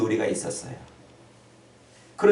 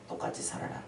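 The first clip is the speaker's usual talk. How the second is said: hushed, breathy echo of the demand; whispered repeat; 14 dB quieter than usual. Acted. Preacher echoing the world's demand, whispered.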